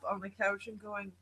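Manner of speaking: ordinary talk, nothing marked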